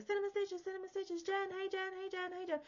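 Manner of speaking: high, eager calls for attention; high-pitched chorus of fans calling her name; mock clamouring fans. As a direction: pester the host like a crowd of fans. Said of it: "everyone's like".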